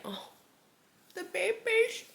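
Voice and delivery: a fond little coo. Pretend. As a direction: melt over the baby. Fond future parent cooing.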